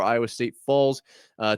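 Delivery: normal talking voice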